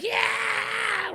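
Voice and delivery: high, drawn-out mock crowd cheer. Audience cheering as the comic kicks out the heckler, long high whoop. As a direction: cheer like the whole room. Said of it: "the audience was like".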